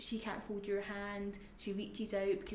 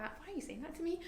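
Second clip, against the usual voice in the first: a higher, lightly voiced confused mother; puzzled mum; 9 semitones higher than usual. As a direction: ask it as her bewildered mum would. Voicing her mum's question.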